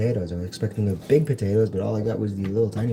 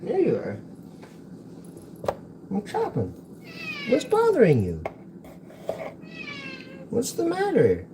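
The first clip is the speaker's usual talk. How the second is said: much higher cooing pet voice; pet talk. Real, not acted. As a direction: ask the cat what's wrong, high and doting. Talking to the cat.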